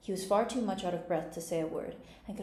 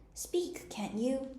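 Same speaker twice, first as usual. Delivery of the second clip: high, half-whispered urging; impatient, airy high-pitched prod; impatient cry; 7 semitones higher, much slower than usual. A narrator's reading. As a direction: urge impatiently in a high, hushed voice. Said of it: "Hare cried impatiently".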